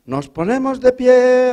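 sung-out priest command; priest's call. Retold or imitated. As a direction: intone like a priest at mass. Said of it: "el cura ha dicho".

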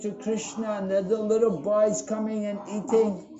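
laughing mock grief over the spoiled food; mock lament. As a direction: ham up the ruined-offering despair. Brahmana laments ruined offering.

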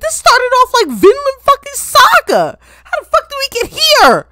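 high, loud, bewildered protest; incredulous outrage. Real, not acted. Viewer protesting how the anime turned out, outraged. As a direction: yell it in sheer disbelief.

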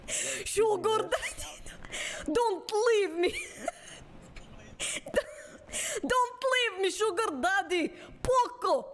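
high pleading cries, drawn out; desperate high wail. Acted. Woman wailing as her sugar daddy runs off. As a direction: beg him not to leave. Begging lover not to leave.

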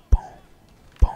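usual speaking voice

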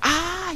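high startled "ah!"; sudden high-pitched yelp; startled cry. Real, not acted. Startled while being attacked.

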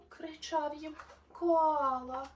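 lively, sing-song storybook narration; animated bedtime-story voice; reading to children. A narrator's reading. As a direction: read the rhymes brightly to little listeners. Reads a rhyming children's story.

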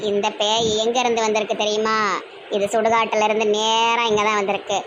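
low, slow holy-person voice; self-important exorcist. Acted. Exorcist instructing the anxious mother, low slow voice. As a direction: spin out the cure slowly, pausing between phrases. Plays exorcist prescribing a cure.

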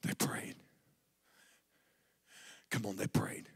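preacher hushing the crowd about prayer; hushed. Acted. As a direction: drop it to a hush to draw them in. Hushed emphasis.

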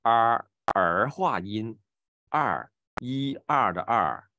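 儿 curled right back in the mouth; back retroflex er. Shown as a demonstration. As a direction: draw out 儿 with a full r-colouring. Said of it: "就完全在后面了".

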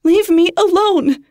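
high, loud frightened scream; terrified scream. Acted. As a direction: scream it at the phone. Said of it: "she screams".

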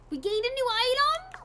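very high, loud rising exclamation; delighted surprise. Real, not acted. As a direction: squeal it as an astonished question. Surprised by new item.

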